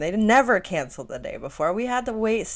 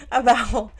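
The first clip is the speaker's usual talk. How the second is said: laughing; 7 semitones higher, 5 dB louder, slower than usual. Real, not acted. Laughing mid-sentence.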